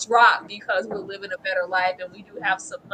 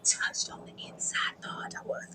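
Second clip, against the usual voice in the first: whisper; 10 dB quieter than usual. Real, not acted. Whispered aside after teasing.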